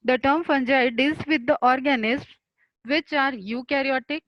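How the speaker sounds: very slow, every word spaced out for students to write; dictating a definition. Shown as a demonstration. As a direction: spell it out slowly for notes. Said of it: "The term, this is definition of fungi". (परिभाषा धीरे-धीरे लिखवाना)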